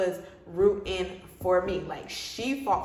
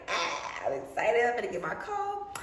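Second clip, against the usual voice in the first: excited; 3 semitones higher than usual. Retold or imitated. To herself in the bathroom, giddy excitement. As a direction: bounce with giddy anticipation.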